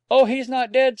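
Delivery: put-on high, loud child's voice; small boy. A narrator's reading. Small boy to the nurse about the dog, high and excited. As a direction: pipe it up like an eager small boy.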